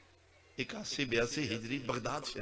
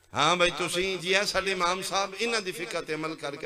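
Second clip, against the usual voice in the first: loud calling out; 5 semitones higher, 5 dB louder than usual. Acted. Row calls out their imam.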